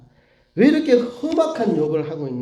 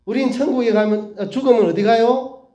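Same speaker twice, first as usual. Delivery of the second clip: high-pitched question to little ones; acted teacher quizzing children; Sunday school teacher; 6 semitones higher, 4 dB louder than usual. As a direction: prompt the class for its answer. Acts teacher quizzing small children.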